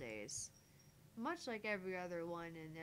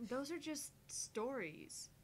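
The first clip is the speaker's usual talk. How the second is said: dismissive; 7 semitones higher, slower than usual. Acted. Dismissive voice of doubt.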